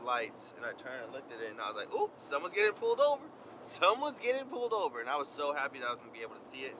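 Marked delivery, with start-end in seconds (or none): 1.9-5.0 high, loud and gleeful, delighted someone else is caught; gleeful excitement. Retold or imitated. Runner gleefully spots a police stop, high excited call. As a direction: cheer it out like a gossip spotting drama.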